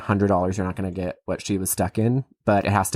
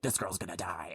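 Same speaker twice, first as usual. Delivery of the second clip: hushed dread; 5 semitones lower, 15 dB quieter than usual. Retold or imitated. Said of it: "it's like".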